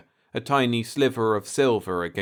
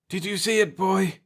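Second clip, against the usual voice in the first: higher, elated call; excited cry; 10 semitones higher than usual. A narrator's reading. Pete to Jeff after the ship vanishes, excited. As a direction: cry out in wonder. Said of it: "he cried".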